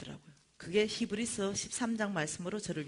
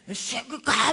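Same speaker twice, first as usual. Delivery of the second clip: loud, raised-pitch yell; imitated angry drunk; 5 semitones higher, 9 dB louder, much slower than usual. Drunk man yelling at dad to leave, angrily. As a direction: chase him off with a mock yell. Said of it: "막 이런데".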